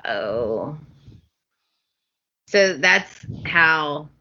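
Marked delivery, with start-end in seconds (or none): 0.1-0.8 soft, low uh-oh; low dread. Retold or imitated. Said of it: "And I'm like".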